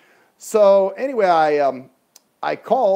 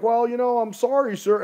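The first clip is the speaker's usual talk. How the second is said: lightly mimicked call taker, higher voice; imitating Carlos; 8 semitones higher than usual. Call taker says sorry to the rider, mimicking higher voice. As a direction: mimic an apologising call taker, voice raised in pitch. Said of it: "he kinda talks like this".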